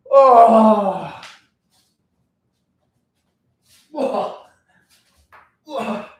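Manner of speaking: stretching groan. Real, not acted. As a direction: groan into the stretch, then let it die away. Groans during his stretch.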